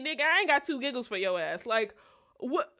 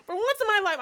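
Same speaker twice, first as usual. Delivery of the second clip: high, loud, exasperated complaint; 10 semitones higher, 5 dB louder than usual. Retold or imitated. Daughter complaining to mom about the calls, high and loud. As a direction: throw your hands up at mom.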